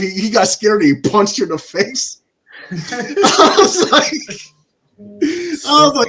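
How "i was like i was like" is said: slow, high, laughing through the words. Real, not acted. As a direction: crack up while speaking. Laughing at the punch scene.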